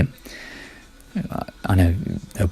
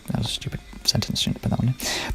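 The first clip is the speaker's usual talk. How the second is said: whispered aside; 3 semitones higher, 10 dB quieter than usual. Real, not acted. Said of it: "muttered aside about his sentence".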